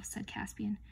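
plain reading voice

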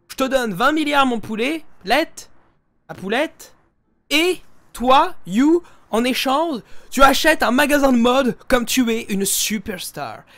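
loud, lightly voiced generous benefactor; playful impression of Tati handing out money. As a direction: boom out a grand promise, a little mocking. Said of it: "Tati, elle me fait".

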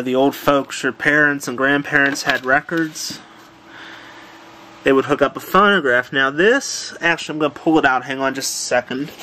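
ordinary delivery

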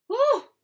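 high, loud "oh"; startled cry. Acted. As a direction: cry out as if ambushed. Startled by the ambush.

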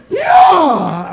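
loud, high whoop; sudden shout. Real, not acted. Comic on stage to the audience, sudden shout. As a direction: belt it out to the crowd.

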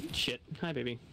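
usual speaking voice

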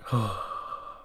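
long, resigned exhale; exasperated sigh. Acted. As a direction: let out a heavy acted sigh. Acted exasperated reaction to spill.

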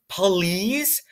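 drawn-out, wheedling please; mock pleading. A narrator's reading. Doctor at the barricaded door, mock pleading. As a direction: stretch it into a rising mock plea.

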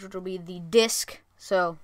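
usual speaking voice

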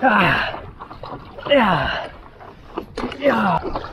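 high strained effort grunts. Real, not acted. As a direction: grunt with each paddle stroke. Grunts while paddling hard.

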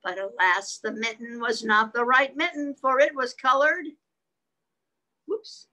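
put-on gentle read-aloud for children; telling the kitten story to little listeners; story narration. A narrator's reading. Parent telling a kitten story to children, gentle read-aloud voice.

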